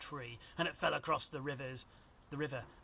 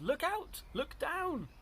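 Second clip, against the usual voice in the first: high-pitched urgent call; warning yell; 12 semitones higher, slower than usual. A narrator's reading. Drip warns his sisters on the bridge, high yell. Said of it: "who yelled".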